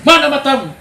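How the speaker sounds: high, loud furious father; angry father. Retold or imitated. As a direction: scold the child who spilled milk. Father scolding child. (ayah yang membentak marah)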